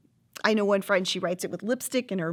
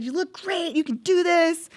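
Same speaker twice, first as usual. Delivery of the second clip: cheering on; 8 semitones higher, 4 dB louder than usual. Retold or imitated. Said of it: "she, like, cheered me on".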